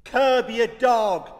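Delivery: loud, slow barked order; grumpy command. Retold or imitated. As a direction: snap it like a grumpy neighbour. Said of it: "repeated Captain Grumpy".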